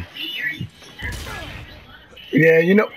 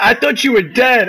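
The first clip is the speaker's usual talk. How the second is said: excited shout; 8 semitones higher than usual. Real, not acted. Relieved shout to teammate.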